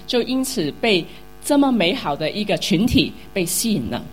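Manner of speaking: ordinary delivery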